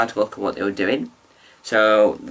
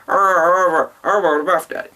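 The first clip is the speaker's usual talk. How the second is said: imitating Lee; 6 semitones higher, 3 dB louder, slower than usual. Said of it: "'Cause he's like".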